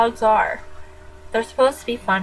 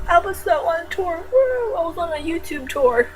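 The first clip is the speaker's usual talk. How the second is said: excited; 7 semitones higher than usual. Real, not acted. Excited about her tour.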